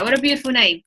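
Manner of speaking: normal talking voice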